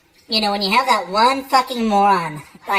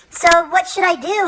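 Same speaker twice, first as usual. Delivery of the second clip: high, needy mock lady; lady asking; 9 semitones higher than usual. Lady asking the psychic for advice, high needy. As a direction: ask helplessly. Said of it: "She goes".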